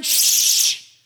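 long, forceful "shh"; loud shush. Acted. Paul hushes the interrupting women with a loud shush. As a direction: shush a noisy congregation hard. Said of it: "Paul is saying".

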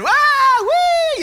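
high, loud woo; hooting. Retold or imitated. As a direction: holler with joy on the boat. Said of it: "hooting and hollering".

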